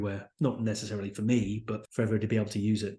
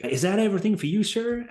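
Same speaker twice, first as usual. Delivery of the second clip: put-on high, fast American accent; chirpy American cashier asking; American accent, pitched up and quick; American shop clerk; 8 semitones higher, 4 dB louder than usual. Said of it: "I'm not gonna do an American accent".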